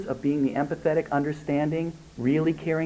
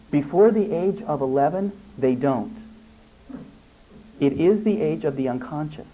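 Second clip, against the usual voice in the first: ordinary delivery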